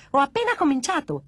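put-on high-pitched baby-doll defiance; bratty baby voice. Doll warns before toppling the tower, bratty baby. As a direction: boast like a naughty baby.

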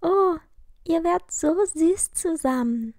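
put-on gushing girl, high and loud. A narrator's reading. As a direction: swoon over the couple. Sagte Mimi.

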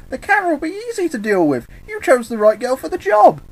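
put-on very high, squeaky girlish voice; squeaky, boastful and loud by the end; Harley. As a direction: chirp it brightly, way up high. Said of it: "with Harley adding".

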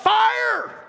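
high, loud alarm shout; sudden full-voiced high yell. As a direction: yell it like raising a false alarm. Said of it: "we just yell out".

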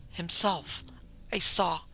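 emphatic, horrified naming; choked with terror. A narrator's reading. Terrified wife tells her husband what she saw, choking. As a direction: break off as if choking on it. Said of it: "she choked".

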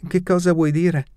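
strained high-pitched question; unnaturally high. A narrator's reading. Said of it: "la mia voce suonò innaturalmente acuta".

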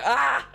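high, loud short shriek; startled scream. Real, not acted. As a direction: yelp in sudden panic. Startled while playing.

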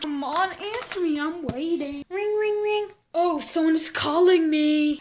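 normal talking voice

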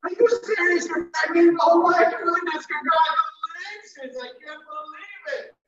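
high-pitched, gushing congratulations; excited squeal, stretched out with lots of intonation; thrilled surprise. Shown as a demonstration. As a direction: react to wonderful news with amazed delight. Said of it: "add variety when you speak, pronunciation, intonation".

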